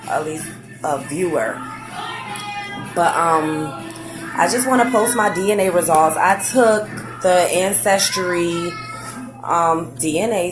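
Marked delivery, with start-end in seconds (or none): none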